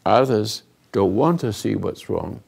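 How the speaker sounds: ordinary talk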